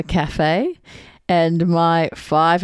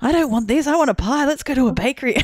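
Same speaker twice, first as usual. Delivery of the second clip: put-on higher, demanding little girl voice; bossy child; 8 semitones higher, faster than usual. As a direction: bark orders like a spoiled toddler. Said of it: "She's barking out orders going".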